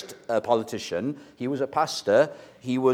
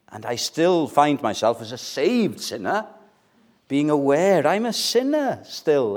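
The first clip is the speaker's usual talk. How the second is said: ordinary talk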